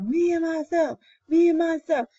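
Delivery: high, proud roared boast; chest-out lion roar. A narrator's reading. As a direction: roar it out like a proud king of beasts. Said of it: "Old He Lion is roaring his head off".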